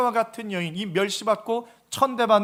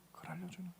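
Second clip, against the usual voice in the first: "그걸 알려주는 거예요" repeated much softer, almost to oneself; soft echo; 5 semitones lower, 25 dB quieter, much faster than usual. Shown as a demonstration. Preacher repeating his point to the congregation, hushed echo. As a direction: let the repeat of "알려주는" fall away to a hush.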